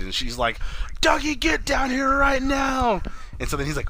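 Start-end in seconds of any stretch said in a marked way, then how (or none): 1.0-3.0 high, drawn-out shouted summons; mock wifely yell, pitched way up; imitating angry wife. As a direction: holler high and slow, like an irate wife. Wife summons husband in retelling.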